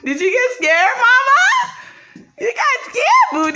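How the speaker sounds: ordinary talk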